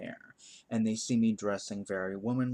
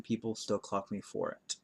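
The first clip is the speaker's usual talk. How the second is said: ordinary talk, nothing marked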